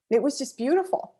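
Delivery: fighting back tears on the last word; near tears. Real, not acted. Recalling the anthem on the riverboat, close to crying. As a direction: voice lifting high as emotion wells up. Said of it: "I'm gonna cry".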